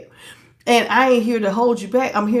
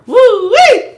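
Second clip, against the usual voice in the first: high loud woo; excited whoop; 13 semitones higher, 10 dB louder than usual. Real, not acted. Exclamation after laughing.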